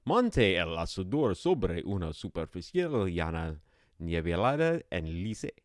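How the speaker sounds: Spanish read slowly in a Spanish accent. Shown as a demonstration. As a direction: sound out the Spanish text in a Spanish accent. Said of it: "This is Spanish".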